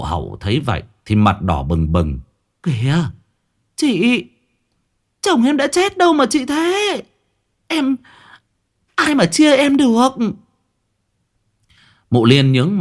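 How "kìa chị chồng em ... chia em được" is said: high, pleading protest; embarrassed protest. Acted. Hậu, red-faced, tells her sister-in-law to stop, high protest.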